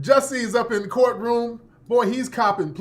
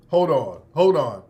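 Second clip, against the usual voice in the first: ordinary talk, nothing marked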